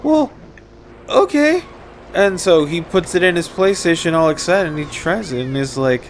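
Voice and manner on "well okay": put-on small, high-pitched child voice; unsure kid. Kid accepting Mom's game, high kid voice. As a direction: squeak it like a kid going along with it. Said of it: "And the kid's like".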